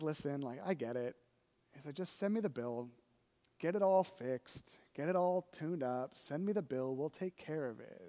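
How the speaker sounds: a hint of a friendly mechanic being generous; lightly voiced kind mechanic. Mechanic reassuring him about the bill, softly.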